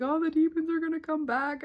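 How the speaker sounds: high, panicky retold voice; hammed-up freak-out about demons; squeaky mock fright. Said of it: "I was, like, tripping out".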